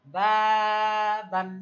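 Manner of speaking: বাবান held slowly and loud; teacher modelling বাবান slowly; slow model word. Shown as a demonstration. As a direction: stretch বাবান clearly. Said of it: "দ্রুত বলতে হবে".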